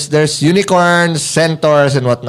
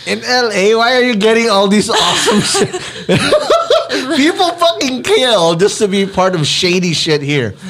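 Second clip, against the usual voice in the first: high, incredulous outburst breaking into laughs; laughing disbelief; 9 semitones higher than usual. Real, not acted. Host teasing guest about her lucky break, laughing in disbelief. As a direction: cry out in amused disbelief, pitched up.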